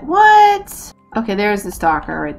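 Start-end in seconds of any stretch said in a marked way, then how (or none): 0.0-0.9 much higher, louder startled What?; shocked what. Real, not acted. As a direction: blurt it out in disbelief. Shocked by the missing stalker.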